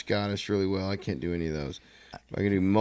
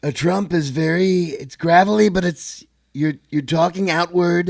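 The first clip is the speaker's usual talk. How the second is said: high, loud gravelly Trump; Trump voice demo; 9 semitones higher, 8 dB louder than usual. Shown as a demonstration. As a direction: project it outward like Trump. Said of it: "the voice is very much in your throat and your nose".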